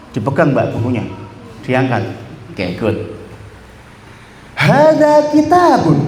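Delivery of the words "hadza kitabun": higher, slow, eager announcement; showing a book. Shown as a demonstration. Teacher acting out showing a book to a friend, excited. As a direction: tell someone proudly about the book. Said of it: "orang yang ingin dikasih tahu".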